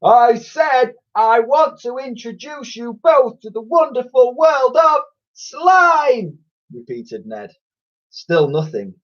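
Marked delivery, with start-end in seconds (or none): 0.0-6.4 very high, slow shout; shouting louder. A narrator's reading. Boy shouting up to the roof, very high and slow. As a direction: belt it out so the roof can hear. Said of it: "Shout louder".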